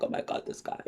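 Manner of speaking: retold breathless whisper to herself; mock swooning whisper. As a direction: whisper it as if secretly smitten. She swoons over his voice.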